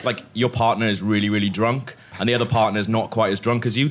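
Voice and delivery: normal talking voice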